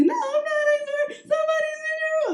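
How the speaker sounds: very high, squeaky panicked wail; hammed-up sobbing squeal; mock crying. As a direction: imitate a hysterical girlfriend in tears.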